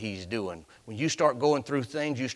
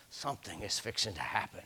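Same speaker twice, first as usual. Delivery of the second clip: ordinary talk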